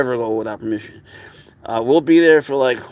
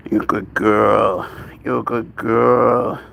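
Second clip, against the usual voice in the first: slow, drawn-out pet praise; doting praise; 2 semitones lower, slower than usual. Real, not acted. To a baby animal being petted, doting praise. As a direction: stretch out the praise lovingly.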